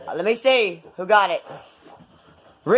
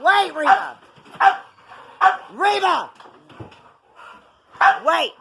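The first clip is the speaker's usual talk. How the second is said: yelling at the dog, high and loud; urgent shout; 9 semitones higher, 3 dB louder than usual. Real, not acted. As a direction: shout it sharply so the dog stops.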